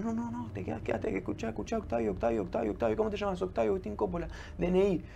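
high, insistent voice of doctors calling his name; urgent doctors. Retold or imitated. Médicos lo mantienen consciente. (imitando a médicos urgentes, voz aguda e insistente)

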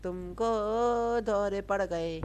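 very slow, stretched teasing; slow mocking singsong; drawn-out taunt. Real, not acted. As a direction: drag out the words to tease. Teasing King Kong, stretched words.

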